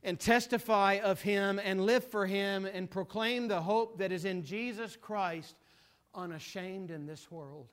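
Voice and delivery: loud, soaring preacher's cadence; preaching at full force. Acted. Preacher rousing his congregation, full force preaching. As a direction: preach it to the back pew.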